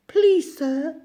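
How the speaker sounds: high, coaxing plea; wheedling washerwoman. A narrator's reading. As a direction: Toad sweet-talking as the washerwoman. Toad begging to drive.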